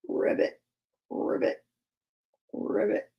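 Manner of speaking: soft, spaced ribbits; frog croak. Acted. Storyteller voicing a frog, soft ribbits. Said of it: "says a frog".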